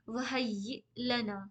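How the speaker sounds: वहय्य लना with doubled य, slow; slow model recitation. Shown as a demonstration. Teacher recites slowly for students to repeat. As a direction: stretch वहय्य, lean on the double य. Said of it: "मेरे साथ साथ आप लोग repeat कर सकते हैं".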